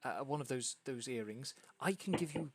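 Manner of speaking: fast, excited stammering impresario; lightly voiced flustered theatre manager; excited hostess. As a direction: babble the plan out breathlessly. GM voicing frantic hostess.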